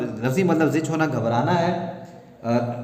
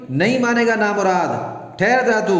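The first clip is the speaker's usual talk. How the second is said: put-on higher, furious threat at the child; angry threat; 7 semitones higher than usual. Wife storms out to stop her son, angry threat. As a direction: snap at the boy to stop. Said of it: "गुस्से में जाती है".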